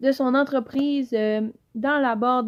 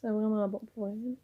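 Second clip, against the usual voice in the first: whisper; 6 dB quieter, faster than usual. Real, not acted. Aside while sniffing the soap.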